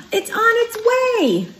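gasping, high excited announcement. A narrator's reading. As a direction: gasp then announce it with wonder. Gasp before announcing.

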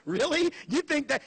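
high, pointed disbelief; mock astonishment. Real, not acted. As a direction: ask it as if it's absurd. Continued incredulity.

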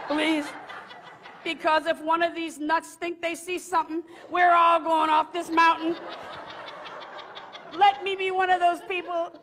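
high, loud comic pleading; desperate prayer. Retold or imitated. Praying not to see an apparition on the mountain, desperate pleading. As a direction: plead to heaven in mock panic, slowly and insistently. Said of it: "So I begin to pray this prayer".

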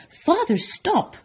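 high, loud, alarmed shout; urgent shout. Acted. Son stopping his baker father, urgent high shout. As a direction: shout to stop him. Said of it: "shouted Walter Junior".

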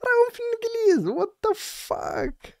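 squeaked up high, then a long stretched what the fuck; outraged disbelief at the officer. Real, not acted. Reacting to the police clip, high stretched disbelief.

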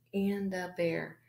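slow, whispered sounding out of Panda Bear; sounding out haltingly. Shown as a demonstration. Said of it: "I might have to sound out".

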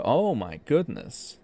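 normal talking voice

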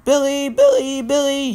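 high, loud repeated call; high sing-out of a name. A narrator's reading. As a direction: call after someone running off. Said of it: "he called sheepishly".

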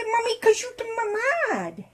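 put-on high, crying little-kid voice for the baby; toddler voice. Mom voicing her baby doll, high and teary. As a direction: speak up as the whiny baby.

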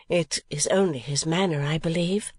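ordinary delivery

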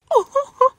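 short, loud high-pitched squeal; delighted high yelp; excited squeal. Real, not acted. Excited squeal on opening bag.

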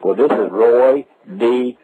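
ordinary delivery

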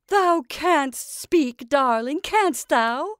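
loud, high, crowing witch; delighted witch's cry. A narrator's reading. Witch finds her creation can speak, crying out loudly. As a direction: cry out, drawn out and pitched high. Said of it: "cried Mother Rigby".

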